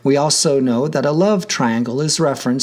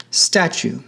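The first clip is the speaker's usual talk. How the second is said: "statue" with the weight on STA; stress on first syllable; 3 semitones higher than usual. Shown as a demonstration. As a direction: lean on the start of "statue". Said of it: "the stress is on the first syllable".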